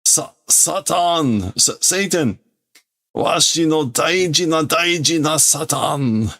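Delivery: put-on high, wailing old man crying out; hammed-up villain lament; anguished old villain. Villain mourns his precious Satan.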